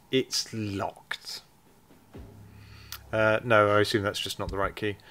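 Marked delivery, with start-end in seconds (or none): 0.1-1.4 slow, talking down to an idiot; mock patronizing tone; condescending. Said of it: "Like he was talking to an idiot".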